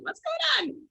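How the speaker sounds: squeaky high puzzled dog. Retold or imitated. Owner voicing her confused dog, high and puzzled. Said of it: "my dog's looking at me like".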